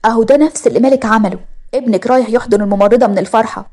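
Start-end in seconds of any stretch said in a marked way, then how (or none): none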